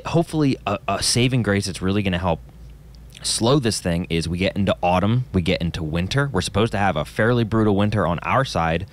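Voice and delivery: ordinary talk, nothing marked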